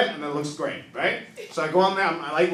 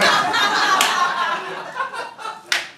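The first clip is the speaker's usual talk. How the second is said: laughing; 13 semitones higher, 5 dB louder than usual. Real, not acted. Laughs after punchline.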